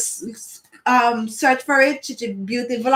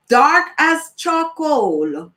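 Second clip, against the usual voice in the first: normal talking voice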